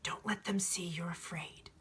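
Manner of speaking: hushed urgent whisper. Acted. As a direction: breathe the warning so no one hears. Alchemist warns frightened boy.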